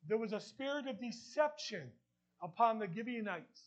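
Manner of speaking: ordinary talk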